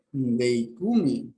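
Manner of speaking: deikumi drawn out very slowly; very slow Greek word. Shown as a demonstration. Teacher giving Greek root: very slow pronunciation. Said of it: "Otra vez para".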